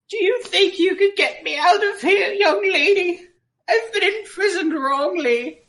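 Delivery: high, wavering granny voice; old lady voice. Acted. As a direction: plead like a frail old woman. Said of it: "my best old lady voice".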